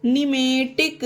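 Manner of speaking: निमेटिक said slowly with no p sound before the n; slow teacher model of निमेटिक, starting straight on the n; silent p demo. Shown as a demonstration. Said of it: "इसे पढ़ते हैं निमेटिक".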